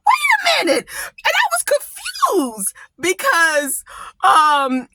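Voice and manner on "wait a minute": stunned double take. Retold or imitated. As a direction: stop everything in disbelief.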